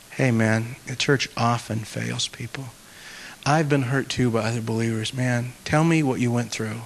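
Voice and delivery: low, soft, confiding tone; gentle sympathy. Acted. Pastor showing how to validate a hurting believer, low and gentle. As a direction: speak quietly, as if consoling a wounded friend. Modelling how to comfort the hurt.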